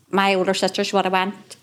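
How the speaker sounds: normal talking voice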